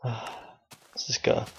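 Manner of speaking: slow, whispered and soft after a sigh. Real, not acted. Gamer psyching up before the dungeon, sighed whisper. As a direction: breathe it out to steady yourself.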